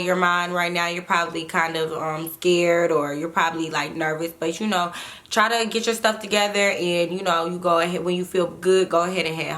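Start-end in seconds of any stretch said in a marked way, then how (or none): none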